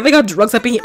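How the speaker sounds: normal talking voice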